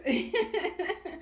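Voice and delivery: short high laugh; bright laugh. Real, not acted. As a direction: laugh at yourself. Laughs after her own remark.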